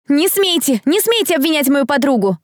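high-pitched protesting shout; indignant cry. A narrator's reading. Girl defending her friend from the crowd, shouting. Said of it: "кричала Дэрил".

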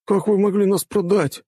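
high-pitched, aggrieved student; offended. A narrator's reading. Student accusing rector of selling them, offended. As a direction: protest as a wounded pupil. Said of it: "обиженно пробубнил один из учеников".

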